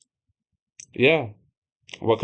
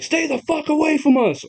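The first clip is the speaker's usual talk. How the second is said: high, yelled threat at a stalker; shouted warning; 14 semitones higher than usual. Retold or imitated. Lee yelling to keep the stranger away, high shout. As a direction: scream at him to back off.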